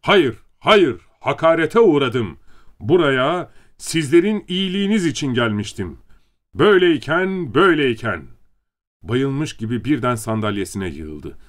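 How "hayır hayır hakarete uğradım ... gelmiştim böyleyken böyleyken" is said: loud, high wounded protest; narrated indignant outburst, pitched up; shouting. A narrator's reading. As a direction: protest the insult, faltering to a stop at the end. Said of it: "General de bağırıyordu".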